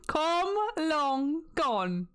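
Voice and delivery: слегка изображает кота из мема «Long Johnson»: выше, медленно; тянет «Come long gone», пародируя кота Long Johnson; mock Long Johnson.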